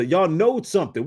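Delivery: ordinary talk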